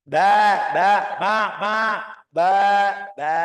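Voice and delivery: BA and MA loud and open; strong, high syllables. Shown as a demonstration. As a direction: project each BA and MA, held slightly. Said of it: "kencang sedikit".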